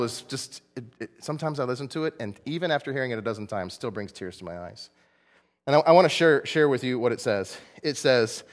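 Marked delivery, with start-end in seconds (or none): none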